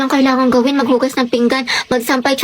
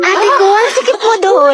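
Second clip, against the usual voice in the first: excited teasing; 4 semitones higher, 3 dB louder, slower than usual. Acted. Friends tease in high voices.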